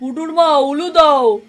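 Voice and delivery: slow, loud call; ceremonial call. Acted. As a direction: call the wife for the ritual. Father calls for ululation.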